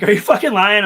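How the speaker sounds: loud, high retold accusation; mock-outraged high question; indignant challenge. Re-enacts partner's challenge.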